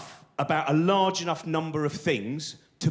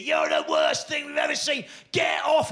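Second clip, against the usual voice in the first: put-on drunken heckler scream; 5 semitones higher than usual. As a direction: hurl it at the stage like a drunk hen-night crowd.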